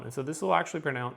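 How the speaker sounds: normal talking voice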